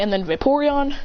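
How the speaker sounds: ordinary talk, nothing marked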